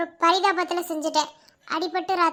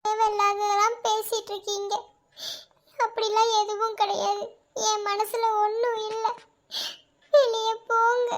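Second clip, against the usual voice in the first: holding back sobs while refusing; a high, tearful refusal; crying; 4 semitones higher than usual. Acted. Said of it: "நீ அழுதுகிட்டே இருந்தா". (அழுதுகொண்டே மறுக்கும் குரல்)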